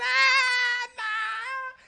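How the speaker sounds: high, acted-out scream of panic; freaking out. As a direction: wail in mock terror. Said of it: "when I'm freaking out".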